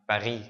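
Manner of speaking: "Paris" said the French way, uvular r. Shown as a demonstration. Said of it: "the French R".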